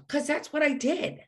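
ordinary delivery

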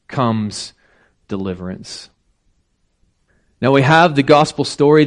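ordinary delivery

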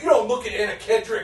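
tough-guy warning. Retold or imitated. Tough dude warns her off looking, mock menace. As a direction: lightly imitate a possessive tough guy. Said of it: "gave me the stink eye".

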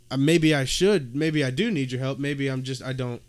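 ordinary talk, nothing marked

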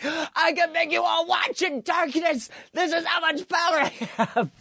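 high, mock-shouted power trip; Vince McMahon boast. Boss lords it over a darkened arena, high mock shout. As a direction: imitate a boss crowing over his power.

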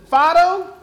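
calling to a dog. Acted. Dog owner cues his dog for a trick, lightly acted calling. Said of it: "looks at his dog and says".

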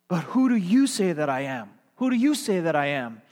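loud, emphatic question leaning on "you"; pointed question. Retold or imitated. Jesus turns the question on the disciples, pointed. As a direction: press hard on "you".

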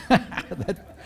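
words broken by laughter; laughing. Real, not acted. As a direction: still laughing as you say it. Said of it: "That cracks me up".

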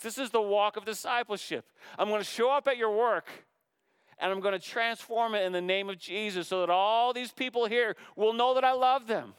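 high, loud, driving sermon voice; full-force preaching. Acted. Preacher to congregation, full-force high loud preaching. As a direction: preach it out at full strength to the church.

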